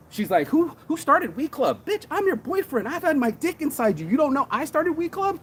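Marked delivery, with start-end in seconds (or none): 0.5-1.8 high, drawn-out mimicry of her; mock incredulous question. 1.8-5.4 high, squeaky-pitched indignant comeback; outraged disbelief. Acted. Acted outraged comeback to girlfriend.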